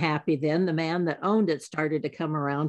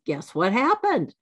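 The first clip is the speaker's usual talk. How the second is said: ordinary delivery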